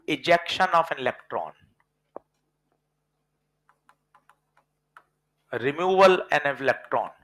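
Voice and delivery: slow, deliberate phrasing; slow dictation. Shown as a demonstration. Teacher rephrasing the definition slowly for students. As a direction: say it slowly so it sinks in. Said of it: "बोले तो".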